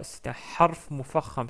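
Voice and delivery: normal talking voice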